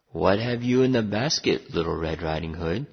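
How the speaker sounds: lightly voiced wolf, dropping low; low, prying storybook wolf; gruff wolf. A narrator's reading. Wolf asking the girl about her basket, gruff voice. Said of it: "a gruff voice said".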